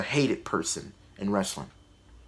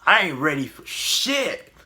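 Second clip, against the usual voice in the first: drawn-out, higher mock outburst; quoted show character; 5 semitones higher than usual. Critic imitates the character's out-of-character joke, drawn-out mock outburst. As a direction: stretch out the character's blurted line. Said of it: "He's like".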